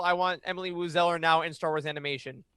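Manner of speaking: usual speaking voice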